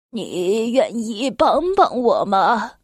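put-on slow, low old man asking; gentle Santa. A narrator's reading. Santa asks the snowman.

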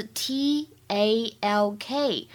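slow, separate letters; measured spelling for learners; spelled out. Shown as a demonstration. Teacher spells out the word.

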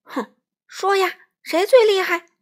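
loud, high, bullying insistence; forceful demand. A narrator's reading. Wolf pressing the pinned crow, loud demand. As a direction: demand the answer loudly. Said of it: "他逼问道".

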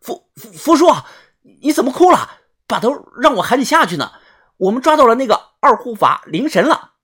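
先结巴，再高声追问; 诧异地抬高嗓音说. Acted. 见福叔流泪，惊讶询问. (惊讶而急切地询问)

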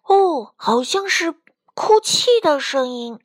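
a touch of amazement; surprised. A narrator's reading. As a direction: react with hushed wonder at a discovery. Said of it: "卡梅利多惊讶地说".

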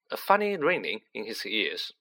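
ordinary delivery

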